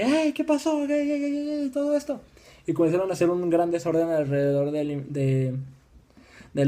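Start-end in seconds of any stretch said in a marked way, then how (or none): 0.0-2.2 high-pitched mock yell at ordinary volume; mock crowd shouting. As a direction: imitate an uproar in a squeaky raised pitch. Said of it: "a gritar de que". (gritos fingidos y agudos de la multitud)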